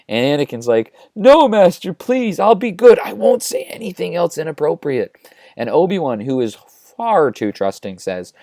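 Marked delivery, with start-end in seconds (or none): 1.2-5.2 high, whiny mock begging; panicked pleading. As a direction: beg your master like a scolded child. Anakin begs master after scolding.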